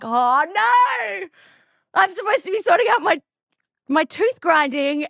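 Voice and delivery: suddenly remembering you're off track; mock panic.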